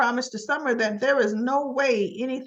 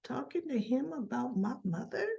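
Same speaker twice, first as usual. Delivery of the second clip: very soft, suspicious aside; inner thought; 3 semitones higher, 10 dB quieter than usual. Retold or imitated. Kyle overhearing talk of his mother, hushed inner thought. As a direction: think it aloud, much softer. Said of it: "Kyle is thinking".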